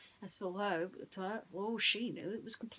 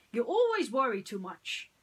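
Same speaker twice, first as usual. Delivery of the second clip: dismissive prince; 4 semitones higher, 8 dB louder than usual. Acted. Prince John dismisses Hiss.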